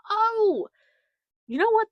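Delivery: high, loud mock realization; sudden realization. Podcaster voicing a listener finally seeing the rocket ship, mock realization. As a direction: act out a big dawning "oh".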